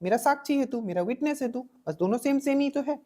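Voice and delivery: usual speaking voice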